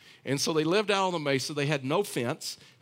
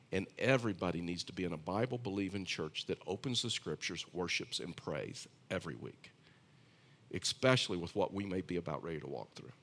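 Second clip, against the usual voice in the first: earnest, sincere answer; 6 semitones lower, 5 dB quieter than usual. Retold or imitated. Pastor's earnest answer to stranger.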